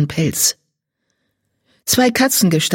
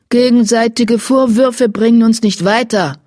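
higher, forceful intervention; firm rebuke; 6 semitones higher, 4 dB louder than usual. A narrator's reading. Blaustern intervenes between Salbeibart and Gelbzahn, firm rebuke. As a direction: step in and stop the blaming. Leader breaks up the mutual blame.

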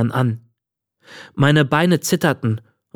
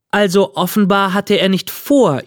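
put-on higher girl's voice, judicial; Miley as judge; 7 semitones higher than usual. A narrator's reading. Miley defends the boy to the old man, like a judge. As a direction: pronounce it like a judge in court. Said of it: "erläuterte Miley wie eine Richterin im Gerichtssaal".

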